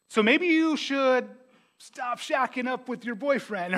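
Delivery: high, drawn-out tentative suggestion; mock tactful pastor. Pastor confronting a churchgoer, hammed-up delicate suggestion.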